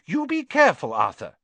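high, loud, sharp warning. A narrator's reading. Missus Weasley cautions Arthur entering the bank, sharp. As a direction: warn your husband sharply. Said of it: "said Missus Weasley sharply".

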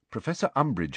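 ordinary delivery, nothing marked

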